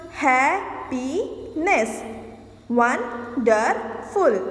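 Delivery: happiness and wonderful stretched slowly, each syllable apart. Shown as a demonstration. Teacher counting syllables for the class, slow and spaced. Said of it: "Three syllables".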